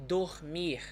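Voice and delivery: DORMIR with both Rs as throaty H; throat-sound R. Shown as a demonstration. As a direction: say "dormir" as "doh-MIHH". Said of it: "with this throat sound".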